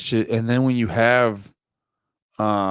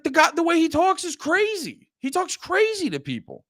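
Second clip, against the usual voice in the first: much higher mock voice; mock onlooker, pitched up high; imitating a bystander. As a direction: toss it off like a bystander shrugging. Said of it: "But you'd be like".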